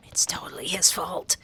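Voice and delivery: whispered snarky aside. Acted. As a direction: mutter it under your breath. Sarcastic aside about Michael.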